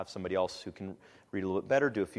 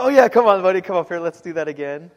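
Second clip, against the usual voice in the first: eager reassurance; 8 semitones higher, 15 dB louder than usual. Retold or imitated. Dad replying to his son.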